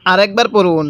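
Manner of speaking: usual speaking voice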